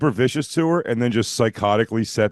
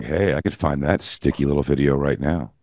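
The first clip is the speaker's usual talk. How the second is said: sultry voice; 9 semitones lower than usual. Retold or imitated. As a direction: mimic her low sultry purr. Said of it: "She's like".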